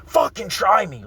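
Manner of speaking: reliving the blow-up at the brawlers; retold screaming. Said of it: "I started screaming".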